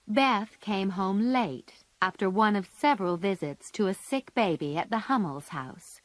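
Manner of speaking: ordinary delivery, nothing marked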